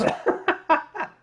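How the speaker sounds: high, bright laughing burst; burst of laughter. Real, not acted. Laughing at the end of his own airport story. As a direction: laugh out loud at the punchline.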